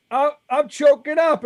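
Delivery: high, loud, hammed-up emotional outburst; mock choking up. Host replays tearing up in an interview, mock choked up. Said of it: "to hold back the tears".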